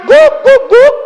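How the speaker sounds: high, loud imitated woofs; dog bark shouted. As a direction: bark three times like an excited dog. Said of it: "Kalau dia udah teriak".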